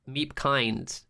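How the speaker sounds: ordinary delivery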